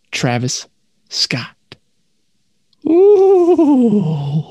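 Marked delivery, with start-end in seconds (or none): 2.9-4.4 spooky ooh. Acted. Spooky reveal reaction.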